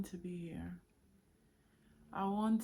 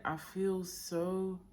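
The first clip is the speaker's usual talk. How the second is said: ordinary talk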